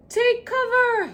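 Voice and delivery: high, loud urgent shout; yelling. Acted. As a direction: shout the warning. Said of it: "he yelled".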